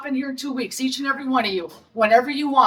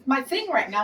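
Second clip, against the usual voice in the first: ordinary talk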